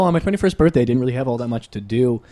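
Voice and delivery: ordinary delivery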